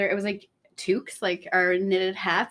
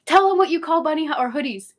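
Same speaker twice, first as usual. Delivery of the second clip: eager friend; 8 semitones higher, 8 dB louder than usual. Retold or imitated. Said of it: "my friend Katie was like".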